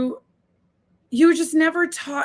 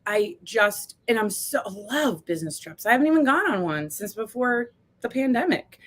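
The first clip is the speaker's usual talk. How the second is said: ordinary talk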